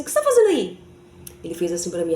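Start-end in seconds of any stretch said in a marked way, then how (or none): 0.0-0.8 much higher, louder shocked shout; startled cry. Retold or imitated. Wife spots her husband up the jabuticaba tree, shocked shout. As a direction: blurt it out in disbelief.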